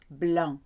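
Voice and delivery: blanc ending on a nasal vowel, no C heard; French blanc, final consonants left unsaid; silent final C. Shown as a demonstration. Teacher shows learners the rule, blanc with silent ending. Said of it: "You cannot hear the end of a French word".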